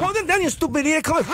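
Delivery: very high, taunting fight talk; high egging-on girlfriend. Retold or imitated. Girlfriend goading her boyfriend into a fight, high and taunting. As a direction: goad the guy into fighting.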